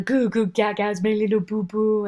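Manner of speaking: lightly voiced goofy baby talk. Dad cooing goo goo gaga at his baby. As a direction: coo nonsense at the baby. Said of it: "Just a little video".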